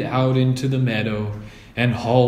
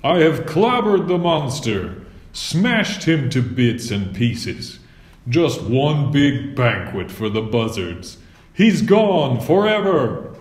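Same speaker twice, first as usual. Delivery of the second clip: boasting knight; 2 semitones higher than usual. A narrator's reading. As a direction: brag of his victory to the farmers.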